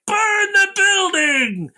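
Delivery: high, slow hammed-up order; mock commander order. Pursuer ordering his men, high drawn-out mock command. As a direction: drag it out like a cartoon villain. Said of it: "and then they're like".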